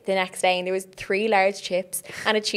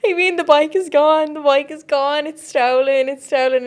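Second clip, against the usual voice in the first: higher, louder, panicked pleading; panicked freak-out; 7 semitones higher, 5 dB louder than usual. Retold or imitated. Calling her friend about the stolen bike, panicked and louder. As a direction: replay the panic of the missing bike. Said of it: "And I was freaking out".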